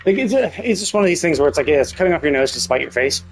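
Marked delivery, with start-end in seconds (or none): none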